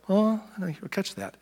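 lazy listener. Retold or imitated. Pastor mimicking idle listeners dodging the message, slow and soft. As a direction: play dumb, slowly. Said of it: "are like".

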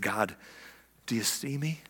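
very soft, low plea to God; hushed prayer. Acted. Nathanael praying to God under the tree, hushed. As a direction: pray it privately under your breath.

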